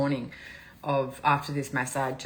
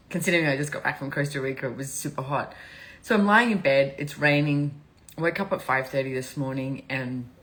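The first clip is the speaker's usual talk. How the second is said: usual speaking voice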